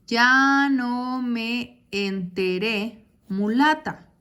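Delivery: slow, drawn-out reading of the verse line; reading a verse slowly. Shown as a demonstration. Teacher reads a poem line to students, slow and drawn out. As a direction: read the line very slowly, syllable by syllable. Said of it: "Así yo separaría normalmente".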